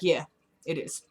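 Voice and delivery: quiet, offhand confirmation; understated shrug of an answer, trailing softly; unfazed. Retold or imitated. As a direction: imitate a man who takes it in stride. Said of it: "he's not freaked out at all".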